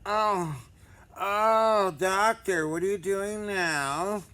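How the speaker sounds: high, loud hammed-up moans at the doctor; mock moaning patient. Pretending a patient moans to his doctor, hammed-up. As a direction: overplay a moaning patient, drawn out. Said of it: "Time to act silly".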